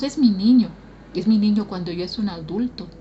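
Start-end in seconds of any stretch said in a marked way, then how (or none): 0.0-0.7 louder, higher, mi stressed and fond; doting parent. Retold or imitated. A parent fondly calls a grown son "mi niño". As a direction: coo over a grown son. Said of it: "Por ejemplo, decimos".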